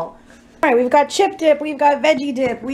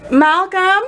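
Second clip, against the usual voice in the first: raised call up the stairs, higher; 7 semitones higher, 4 dB louder than usual. Real, not acted. Vlogger calls her brother to come up. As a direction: call his name from another room.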